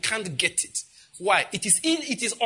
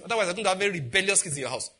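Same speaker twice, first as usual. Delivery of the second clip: normal talking voice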